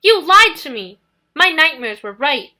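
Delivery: high, very loud furious yell; angry yell. Acted. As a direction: yell it at the liar. Nico yelling at Percy.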